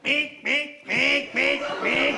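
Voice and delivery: squeaky animal cry; shrill creature noise; animal call. Acted. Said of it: "Pousse-toi".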